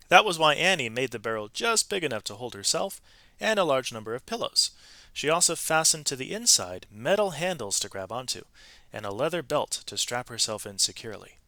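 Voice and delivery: lively read-aloud storytelling voice; expressive storybook narration; story narration. A narrator's reading. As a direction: tell it like a picture book to children. Reads a picture-book story aloud.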